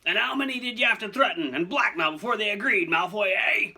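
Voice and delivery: loud, high, furious bellow; roar. Acted. Hagrid accusing Malfoy of blackmail, roaring. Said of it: "he roared".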